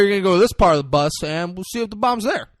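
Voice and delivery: high, fast, loud silly instructions; goofy instructing voice. Retold or imitated. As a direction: rattle it off like a dopey cop. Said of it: "He's like".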